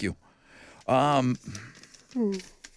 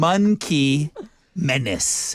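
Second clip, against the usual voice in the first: over enunciating; much slower than usual. Shown as a demonstration. Slowed repeat for lip reading.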